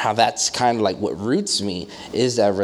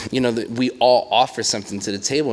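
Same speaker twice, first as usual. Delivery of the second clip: ordinary talk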